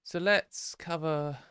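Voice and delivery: ordinary talk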